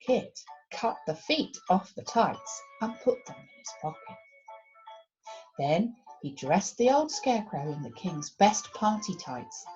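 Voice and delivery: ordinary delivery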